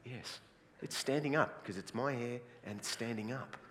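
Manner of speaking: quiet, deadpan explanation; unfazed reply. Acted. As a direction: explain it softly, as if perfectly obvious. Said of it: "And I go".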